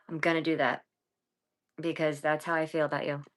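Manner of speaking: normal talking voice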